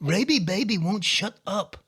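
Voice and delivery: high, slow talking-dog voice; Norma. Acted. Owner voices his dog complaining, high slow dog voice. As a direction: complain slowly as a fussy pet. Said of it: "That's Norma".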